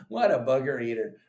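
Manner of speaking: ordinary talk, nothing marked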